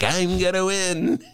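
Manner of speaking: slow, high, smug "I'm gonna win"; smug Trump impression. Host mocking Trump as sure of winning, slow smug voice. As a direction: drag it out like a smug winner. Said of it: "sailing along like".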